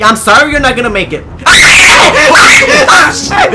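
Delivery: loud, high laughter into a yelp; laughing shout. Real, not acted. Gamer watching an enemy slip away, laughing shout. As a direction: burst out laughing mid-shout.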